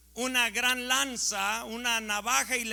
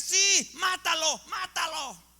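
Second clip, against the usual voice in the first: higher, louder egging-on cry; imitated cheering shout; 7 semitones higher, 4 dB louder than usual. Preacher voicing a woman at the party, imitated cheering shout. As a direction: shout it like an onlooker urging her on. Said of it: "Y dice una de ellas".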